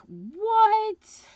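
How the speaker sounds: high, loud drawn-out disbelief; incredulous. Retold or imitated. Telling viewers about the creepy assistant, loudly incredulous. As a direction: blurt it out, stretched and rising sharply.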